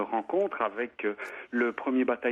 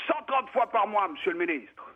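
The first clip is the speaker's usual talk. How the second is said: para at attention, pitched way up; soldier answering; 6 semitones higher than usual. Retold or imitated.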